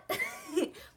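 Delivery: sudden high laugh; high burst of laughter. Real, not acted. Laughing at her own retort to listeners, high laugh.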